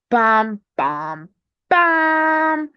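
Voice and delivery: three spoken impacts, last one held high and loud; punchy bam sound effects. Pretend. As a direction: play-act the blows landing. Sound effects for seizing Toronto.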